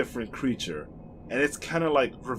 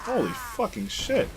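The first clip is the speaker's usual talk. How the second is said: higher, startled outburst; shocked exclamation; 11 semitones higher than usual. Real, not acted. Streamer reacting to a sudden death, shocked and higher. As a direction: blurt it out in disbelief.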